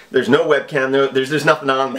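ordinary talk

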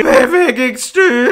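announcer voice. Acted. Mock title call.

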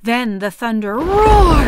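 loud, slow roar; booming narration. A narrator's reading. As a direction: let the thunder roar through it. Dramatic thunder narration.

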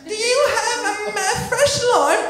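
very high, slow English patron; high put-on English customer voice; English bar customer. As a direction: ask haltingly in a high English voice.

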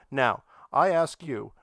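usual speaking voice

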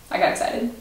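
sheepish whispered aside. Real, not acted. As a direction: whisper an embarrassed apology. Apologising after an outburst.

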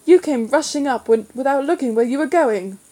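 feisty indignant comeback; spirited protest. A narrator's reading. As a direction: throw the blame back with spirit. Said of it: "she said with some spirit".

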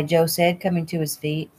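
usual speaking voice

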